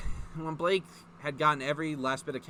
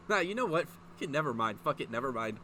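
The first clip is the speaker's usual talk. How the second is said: ordinary delivery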